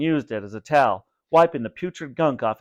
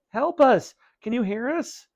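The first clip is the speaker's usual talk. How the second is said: calling out; 11 semitones higher than usual. A narrator's reading. Vinny calling to parents, high desperate call. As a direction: shout toward the next room.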